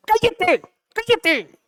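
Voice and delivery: imitación aguda de quien manda callar; orden de callar. Acted. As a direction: corta al ciego con una orden muy aguda. La gente manda callar al ciego. (regaño agudo y tajante, actuado)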